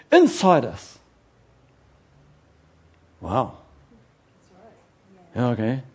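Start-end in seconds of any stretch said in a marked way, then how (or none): none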